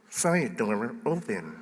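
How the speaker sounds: very soft, slow automated voice; Telus alarm lady. Retold or imitated. Pastor redoing the alarm lady impression, soft slow announcement. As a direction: drop right down into a hushed, slow system announcement. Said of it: "Or it'd be more like".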